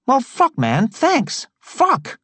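very high, stunned street voice; amazed Travis. A narrator's reading. Travis amazed his record is sealed, very high. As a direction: gasp it out in disbelief. Narrator voices Tea Cake.

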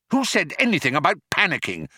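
high, quick snapped retort; snapping. Acted. Arthur answering Ford's plea to stop panicking, snapping. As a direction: snap back defensively, fast. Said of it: "snapped Arthur".

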